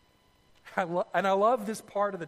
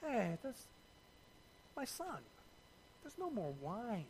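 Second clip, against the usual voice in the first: hushed mob-boss murmur, much softer; a mother tipping off her son quietly, like The Godfather; 14 dB quieter than usual. Acted. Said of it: "kinda sounds like The Godfather".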